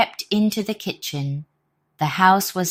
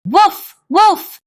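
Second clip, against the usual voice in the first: much higher, louder dog barks; loud barking; 11 dB louder than usual. Acted. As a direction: bark at the robbers. Animal noise attack.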